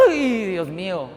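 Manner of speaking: slow, drawn-out dismayed "ay"; aghast exclamation. Shown as a demonstration. Preacher reacting to a harsh scripture, aghast and slow. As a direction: trail off softly in acted dismay. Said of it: "me quedo así como".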